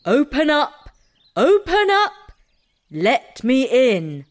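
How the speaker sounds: loud, high calls to open up; calling out. A narrator's reading. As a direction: call out at the door. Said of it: "and called out".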